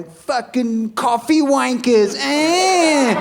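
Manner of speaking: high, loud, slow jeering yell; yelled bogan taunt. Retold or imitated. Teenage brothers jeering at the coffee club, loud drawn-out yell. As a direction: shout it out the car window at the cafe. Yelling from car at cafe.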